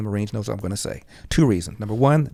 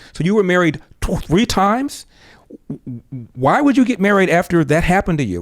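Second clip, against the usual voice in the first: incredulous; 7 semitones higher, 4 dB louder than usual. Retold or imitated. Son incredulous at third marriage.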